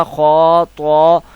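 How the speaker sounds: خو، تو drawn out with a rounded, thick vowel; full, heavy letters. Shown as a demonstration. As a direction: fill the mouth on خو and تو. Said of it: "یہ دونوں حروف پر پڑھے جائیں گے".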